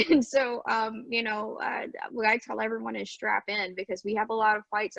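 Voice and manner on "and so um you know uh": normal talking voice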